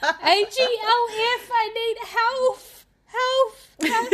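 high, slow pleading; mock desperate moan. Exhausted person moaning for health food, high and slow. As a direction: beg like a wretched creature.

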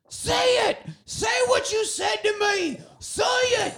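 put-on high shrieking child yelling; screaming kid voice. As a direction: shriek it like a wild little kid. Kid arsonist yelling in retold scene.

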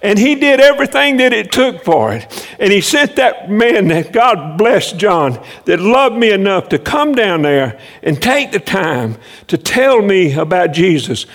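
ordinary talk, nothing marked

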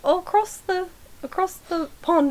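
high, soft, slow English accent; attempted English accent. Shown as a demonstration. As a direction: feel your way into a fake English accent. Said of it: "don't even think I sound English".

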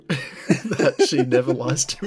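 slow words broken by laughter; real giggles between the words; laughing. Real, not acted. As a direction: laugh through the whole line. Laughing while speaking.

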